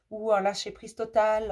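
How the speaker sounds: usual speaking voice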